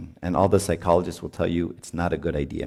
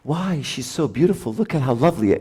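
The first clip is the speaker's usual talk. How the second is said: much higher, overdone sweet praise; mock gushing husband; 6 semitones higher than usual. Priest mocking the husband's fake praise of his wife. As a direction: parody his syrupy compliments. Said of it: "don't do the whole".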